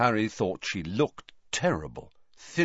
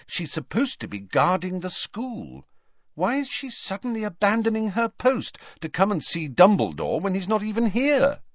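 lightly voiced worried Hermione; 10 semitones higher than usual.

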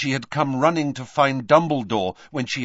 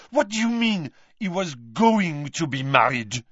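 put-on French-accented, high indignant demand; 6 semitones higher than usual. A narrator's reading. Fleur confronting Mrs Weasley, French accent, indignant. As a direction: press her in a French accent. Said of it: "suddenly and loudly".

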